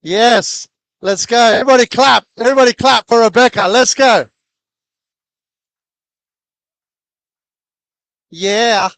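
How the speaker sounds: very loud, high crowd-hyping shout; rally the room to clap; hype shout. Real, not acted. Host getting audience to clap.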